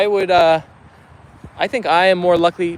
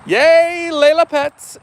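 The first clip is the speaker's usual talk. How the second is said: high, louder happy cheer; excited cheer; 10 semitones higher, 4 dB louder, much slower than usual. Real, not acted. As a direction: cheer as the dog finally gets petted. Cheering as dog gets pets.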